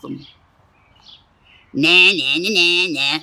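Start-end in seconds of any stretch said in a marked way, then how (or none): 1.7-3.2 a snorting, teasing nah nah; lightly voiced snorting bird. A narrator's reading. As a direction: taunt like a cocky bird. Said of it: "snorted the Fugle bird".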